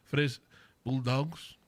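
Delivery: a slight French lilt on Bulldogs; French accent. Pretend. Host keeps up a hint of French accent for the team. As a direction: try Bulldogs with a shaky French accent, rising. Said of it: "I'm trying my best here, my French accent".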